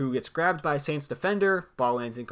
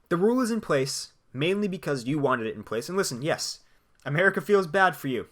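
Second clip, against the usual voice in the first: normal talking voice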